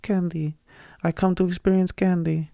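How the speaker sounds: high, hammed-up Swedish visitor voice; a foreign exchange student who has come to experience candy; a hammed-up Swedish accent; mock Swedish accent. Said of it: "act like I'm Swedish or a s-, foreign exchange student".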